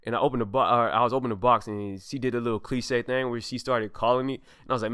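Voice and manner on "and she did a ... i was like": ordinary delivery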